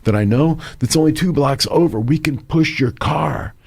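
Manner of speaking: high, eager helpful friend, rushing at the end; eager buddy. Retold or imitated. Buddy eagerly telling his friend about a nearby garage, high and quick. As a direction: pitch the mechanic like great news. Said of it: "He goes".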